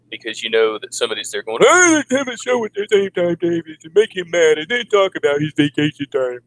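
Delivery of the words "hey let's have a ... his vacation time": high mocking voice, much higher; mocking rival voice. Rival streamers plotting to annoy David, high mocking voice. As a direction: sneer it out as the scheming rival.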